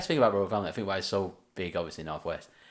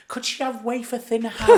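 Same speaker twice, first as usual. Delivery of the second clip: put-on much higher, laughing old lady voice; imitating Nana; 13 semitones higher, 5 dB louder than usual. Friend quoting Nana about wafer thin ham, high. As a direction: ask it sweetly up high. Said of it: "And Nana goes".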